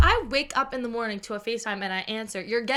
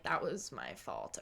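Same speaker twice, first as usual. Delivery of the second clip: softer, lower, slow owning up; sheepish admission; 7 semitones lower, 10 dB quieter, slower than usual. Retold or imitated. Admitting to herself she cut someone off, sheepish and soft. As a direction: quietly concede the mistake. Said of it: "I'm like".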